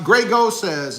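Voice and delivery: usual speaking voice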